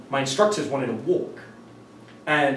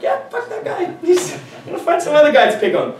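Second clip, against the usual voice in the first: much higher, offhand voice; high-pitched dismissive aside; mock mugger dismissal; 5 dB louder than usual. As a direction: shrug him off in a high mock voice. Mugger deciding to leave.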